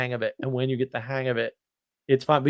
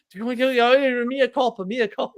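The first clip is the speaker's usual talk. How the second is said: sheepish apology; 10 semitones higher than usual. Retold or imitated. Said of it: "And I'm like".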